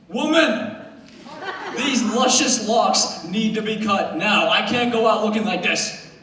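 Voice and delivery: slow, dramatic mock demand; indignant outburst. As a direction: protest to mom in hammed-up indignation. Defiant reply to mom.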